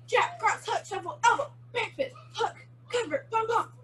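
quick, high-pitched combo calls; sped up. Shown as a demonstration. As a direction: rattle off the moves for the class, high. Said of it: "we're gonna speed it up".

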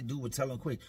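normal talking voice

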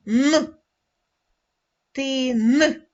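hard Н alone, then Ти stretched and a separate н. Shown as a demonstration. Teacher confirming the sound for pupils, hard Н. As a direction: say Н unpalatalized, then split Ти from н. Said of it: "Це звук твердий".

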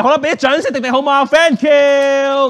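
high, projected showman shout; rally the crowd to clap; applause call. Real, not acted. Magician asks children to applaud helper, rousing shout.

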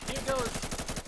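high loud hype; excited. Real, not acted. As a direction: caster calling the kill. Caster repeating excited call.

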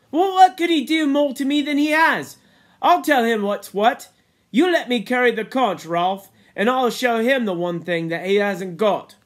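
very high, loud insistence; defiant Piggy. Acted. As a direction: burst out loudly, determined to be heard. Voices Piggy's defiant speech.

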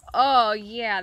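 ordinary talk, nothing marked

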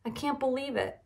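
high, plaintive disbelief; sad. A narrator's reading. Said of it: "Buster said sadly".

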